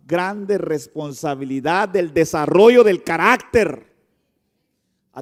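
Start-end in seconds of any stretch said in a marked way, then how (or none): 1.9-3.9 loud, slow emphatic preaching. Acted. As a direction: preach it out with full force. Slow emphatic sermon phrase. (predicación enfática y lenta)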